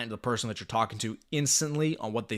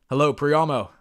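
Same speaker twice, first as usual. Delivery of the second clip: firm, louder, assured "Hello, Priyamo"; confident greeting; 4 semitones higher, 9 dB louder than usual. Shown as a demonstration. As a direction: own the call from the first word. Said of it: "I speak with confidence and conviction".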